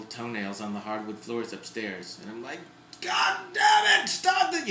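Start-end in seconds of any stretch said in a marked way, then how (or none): 3.0-4.7 high, loud, strained yell at the dogs; exasperated yell. Retold or imitated. Mom yelling upstairs at noisy dogs, exasperated shout. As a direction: snap at the dogs to stop, much higher and louder.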